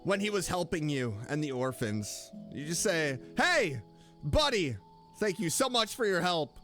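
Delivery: ordinary delivery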